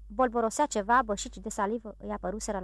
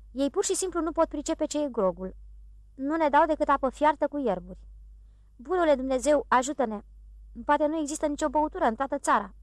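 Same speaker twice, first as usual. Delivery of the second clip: lightly voiced sailor lamenting, higher and quicker at the end; dismayed sailor; 5 semitones higher than usual. Sailor laments to his mates there's no drink, mildly raised. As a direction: moan to heaven about the missing grog.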